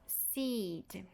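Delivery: "Seed" with a held ee; quiet, drawn-out long vowel in "Seed". Shown as a demonstration. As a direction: softly stretch the ee in "Seed". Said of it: "uma vogal longa".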